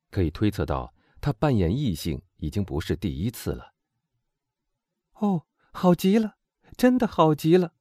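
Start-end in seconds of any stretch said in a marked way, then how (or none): 5.2-7.7 high, delighted praise; admiring girl, high and thrilled. A narrator's reading. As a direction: marvel at a friend, pitched high. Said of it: "罗西赞美的目光望着他说".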